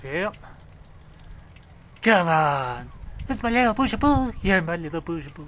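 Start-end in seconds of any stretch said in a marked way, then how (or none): 2.0-5.5 put-on cooing baby talk. Cooing to the storm.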